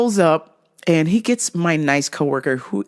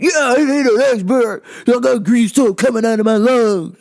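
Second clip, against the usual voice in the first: imitating the yelling customer, higher and louder; 5 semitones higher, 5 dB louder than usual. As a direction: holler it at the poor clerk. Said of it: "I could see him yelling at my coworker".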